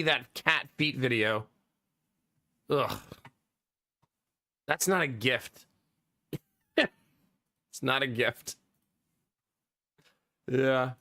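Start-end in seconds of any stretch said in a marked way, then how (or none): 2.7-8.6 disgusted. Real, not acted. Disgusted at cat feet video.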